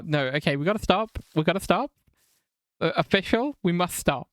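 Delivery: usual speaking voice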